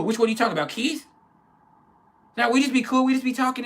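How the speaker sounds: very high, loud mock-female voice; imitated girlfriend. Girlfriend explaining the guy at her job, imitated high defensive voice. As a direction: protest innocence in a squeaky-high imitation.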